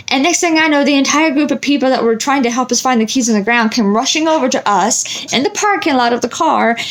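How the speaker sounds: usual speaking voice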